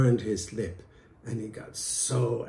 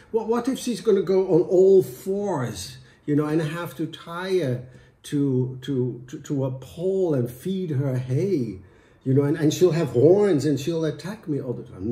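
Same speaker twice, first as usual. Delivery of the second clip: louder, rushing worry; panicked husband; 5 semitones higher, 8 dB louder than usual. Acted. Husband imagining his goat wife, panicking. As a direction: spiral into panic.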